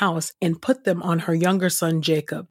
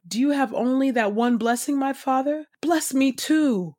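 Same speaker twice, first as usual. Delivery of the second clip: a son pleading with his father, lightly weeping; tearful plea; 7 semitones higher than usual. A narrator's reading. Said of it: "Then Esau wept loudly".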